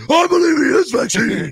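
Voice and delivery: high, loud mock shout; retold shout. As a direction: blurt it out loud, caught out. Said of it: "took Maxwell's silver hammer".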